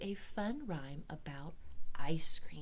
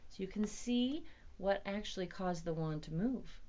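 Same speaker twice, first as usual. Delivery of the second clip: ordinary delivery, nothing marked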